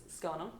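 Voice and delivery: "'S going on" slurred into one quick phrase; casual Australian contraction of "going on"; run-together Australian greeting. Shown as a demonstration. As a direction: merge the words of "'S going on". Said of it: "no one actually says it's".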